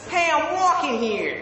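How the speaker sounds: very high, brash American "wawkin' here"; American accent. Shown as a demonstration. Brit trying film line, brash American accent. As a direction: protest it as an indignant American. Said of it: "my best American accent".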